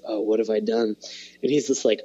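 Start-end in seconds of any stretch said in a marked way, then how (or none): 0.0-1.0 lower, grave murmur from the dad; a hint of a stern father realizing his mistake; deep, serious dad. Retelling the cartoon dad's regret, lightly voiced, low and grave. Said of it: "a deep, serious, like".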